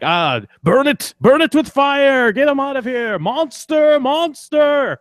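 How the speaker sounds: high, loud panicked mock yell; mock frightened yell. Imagined onlooker panics at the revived man, high mock yell. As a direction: shriek it like a scared villager.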